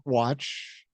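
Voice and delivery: normal talking voice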